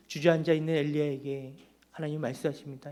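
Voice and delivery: normal talking voice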